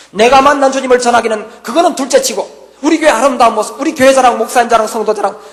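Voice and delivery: loud, high fervent preaching breaking into laughter; rising to the sermon's climax; impassioned preacher proclaiming pride in his church; preaching at full force. Acted.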